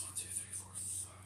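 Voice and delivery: counting the games in a whisper under breath; tally them up in a low whisper. Real, not acted. To himself while counting the new games, whispering.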